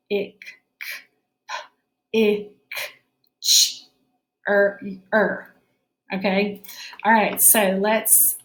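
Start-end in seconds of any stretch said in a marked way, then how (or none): 0.1-5.5 "P, ick, ch, er" broken into separate sounds; slow phonics segmenting; sounding out. Shown as a demonstration. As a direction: segment "picture" sound by sound, like a teacher. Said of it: "let's work on that one".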